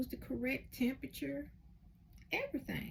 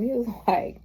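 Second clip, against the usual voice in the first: usual speaking voice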